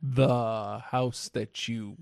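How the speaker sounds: slow, puzzled, faintly irritated; slow confused delivery. Shown as a demonstration. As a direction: stretch "The house that you" out very slowly, baffled. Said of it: "Slow, confused, a little bit upset and pissed off".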